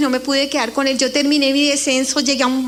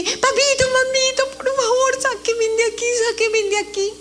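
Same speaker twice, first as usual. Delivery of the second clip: joyful pleading; 9 semitones higher than usual. Retold or imitated. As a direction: call to mom and dad with sudden hope. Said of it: "me dio tanta alegría y empecé". (hija suplica a sus padres con esperanza)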